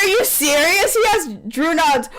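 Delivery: ordinary talk, nothing marked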